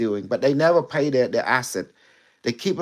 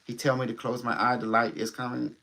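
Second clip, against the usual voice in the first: ordinary talk, nothing marked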